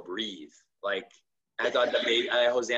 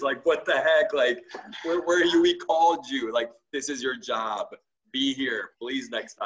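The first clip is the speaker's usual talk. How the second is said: louder, higher retold scolding; father scolding late paramedics, raised and indignant; angry rebuke; 4 semitones higher, 4 dB louder than usual. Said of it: "I'm just like".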